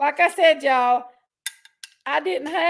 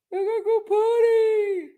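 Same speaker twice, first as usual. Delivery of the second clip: high, squeaky pet voice; high baby-talk dog voice; dog's voice; 7 semitones higher than usual. Pretend. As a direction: speak for the dog in a little high voice. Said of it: "That's what she says".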